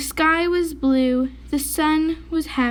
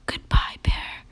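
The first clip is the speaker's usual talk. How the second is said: murmured. A narrator's reading. Mouse saying goodbye to the dying bear, murmured. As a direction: murmur it gently. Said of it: "murmured Mouse".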